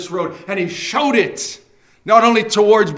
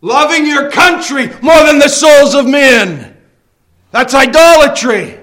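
loud, higher full-force sermon; fiery preaching; 6 semitones higher, 9 dB louder than usual. Acted. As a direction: thunder it from the pulpit.

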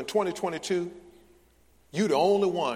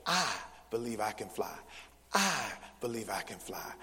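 low, "I" held out, then a pause; mock congregational recitation stressing "I"; 4 semitones lower, 5 dB quieter than usual. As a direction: lean on the first "I" of "I believe". Mock chant, first word stressed.

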